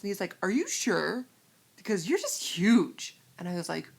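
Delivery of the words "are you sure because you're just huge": loud, drawn-out disbelief; mock amazement, stretching the last word; imitated tactless older man. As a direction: imitate an older man's booming doubt.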